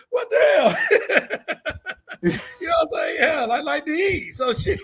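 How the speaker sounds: high-pitched talk through laughter; real laughing. Real, not acted. Man teased about liking to cook, laughing as he talks. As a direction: crack up while protesting.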